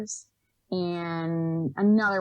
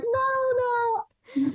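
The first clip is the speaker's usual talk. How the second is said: put-on much higher, amused British-accented no; laughing no. Interviewer laughing off the scam question, higher and amused. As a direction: laugh it off, reassuring. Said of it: "She laughed and she was like".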